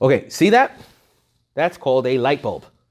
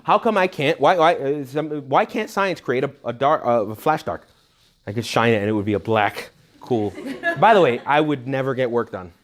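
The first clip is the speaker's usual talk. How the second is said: normal talking voice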